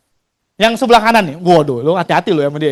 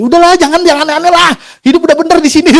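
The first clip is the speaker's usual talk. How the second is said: high-pitched boss talking him out of it; boss dissuading; 9 semitones higher, faster than usual. Retold or imitated. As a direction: coax him to stay, voice pitched way up. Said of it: "Dia motivasi gue".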